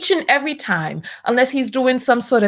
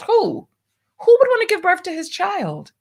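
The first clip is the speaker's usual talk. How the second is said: pitched way up in disbelief, who would; asking in shocked disbelief, voice high; incredulous; 9 semitones higher than usual. Real, not acted. Host asks viewers in disbelief, voice climbing high. Incredulous rant about the man.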